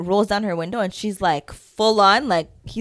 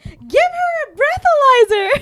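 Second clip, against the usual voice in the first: slow, high, loud mock demand; imitated screaming; 5 dB louder than usual. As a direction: imitate a friend shrieking an order from the back seat. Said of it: "screaming from the back seat".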